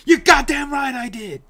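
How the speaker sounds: loud, high defiant shout; Few Good Men outburst. Retold or imitated. Quoting the film on stream, a loud defiant roared confession. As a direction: roar the confession proudly. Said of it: "That's A Few Good Men".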